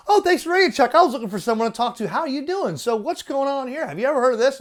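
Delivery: mock eager radio caller; eager chatter. Shown as a demonstration. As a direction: gush like a lonely caller. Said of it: "they'll come right back".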